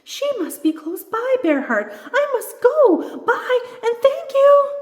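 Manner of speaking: very high, loud, excited squeal; excited little doll shouting goodbye. A narrator's reading. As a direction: call out in a thrilled rush to leave. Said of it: "says the little spir-, spirit doll excitedly".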